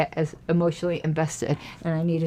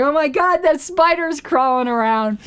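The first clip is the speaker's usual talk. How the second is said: higher-pitched mock panic; mock freak-out; 7 semitones higher than usual. Imagined viewer spots the spider crawling around, mock freak-out. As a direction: play a spider-phobe lightly panicking. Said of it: "probably freaking out too, going".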